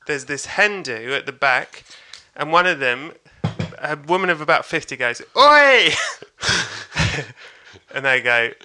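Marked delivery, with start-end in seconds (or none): 5.4-5.9 high, loud heckling yell; heckler's shout. Retold or imitated. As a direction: call out sharply from the back of the club. Heckler at gig calling out.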